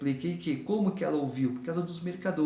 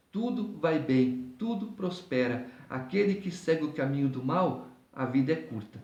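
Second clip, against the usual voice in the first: normal talking voice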